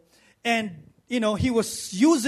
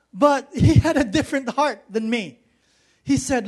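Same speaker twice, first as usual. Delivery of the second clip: usual speaking voice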